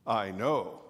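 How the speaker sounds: deep wolf voice. Acted. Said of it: "what a deep voice you have".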